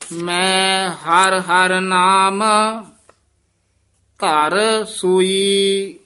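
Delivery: slow, drawn-out chanted reading; slow devotional chant; Gurbani recitation. Acted. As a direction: intone each line like scripture. Chants the hymn slowly.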